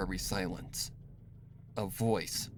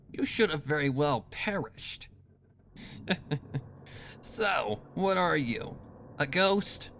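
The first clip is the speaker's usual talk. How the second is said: Rainbow Dash, gloating; 7 semitones higher than usual. Acted. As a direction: relish every threat. Rainbow Dash taunting Scootaloo.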